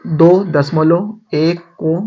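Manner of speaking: each word spaced out and slow; teacher dictating a sum, slowly; slow dictation. Shown as a demonstration. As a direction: spell the number out slowly for pupils to write. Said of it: "dictating a sum to pupils".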